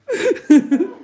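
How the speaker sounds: squealing high laugh. Real, not acted. Laughing about phone dying.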